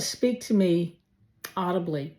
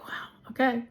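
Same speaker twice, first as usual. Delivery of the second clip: hushed, taken aback; a breathless whispered wow; stunned whisper; 5 semitones higher, 3 dB quieter than usual. Retold or imitated. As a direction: whisper it in disbelief. Retold stunned reaction to denial.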